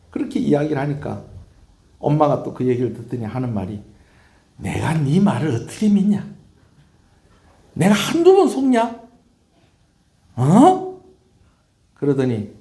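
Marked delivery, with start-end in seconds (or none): 4.6-11.0 high, loud, indignant questions; disbelieving mother. Acted. Mother to son who promises to improve, indignant. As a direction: challenge him, rising to a sharp demand.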